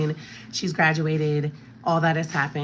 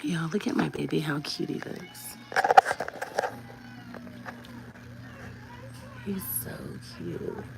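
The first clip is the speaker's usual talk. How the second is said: whispering; 3 semitones higher, 7 dB quieter than usual. Real, not acted. Whispering over sleeping baby.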